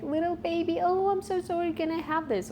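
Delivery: put-on high, babyish, over-apologetic ask. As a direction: coo like a small child asking. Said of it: "the intonation we're using".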